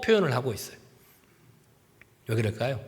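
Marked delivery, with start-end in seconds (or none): none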